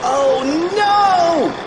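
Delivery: high, drawn-out cry of dread; hammed-up terrified wail; panicked cry. A narrator's reading. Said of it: "cried Fluffy".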